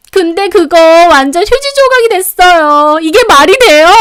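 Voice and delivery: high, loud anguished outcry; distraught wailing. A narrator's reading. Youngest sister-in-law over her lost investment, distraught wailing. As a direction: wail in outrage at the loss. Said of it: "막내 동서는 펑펑 울더라고요".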